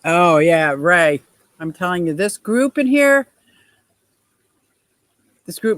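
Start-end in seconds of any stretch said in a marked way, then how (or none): none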